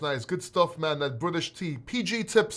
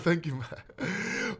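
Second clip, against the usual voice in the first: laughing; 6 dB quieter, much slower than usual. Real, not acted. Laughing thanks.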